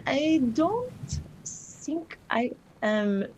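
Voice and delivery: ordinary delivery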